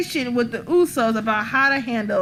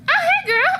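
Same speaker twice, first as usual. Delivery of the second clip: imitating a friendly greeting; 4 dB louder than usual. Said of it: "And she was like".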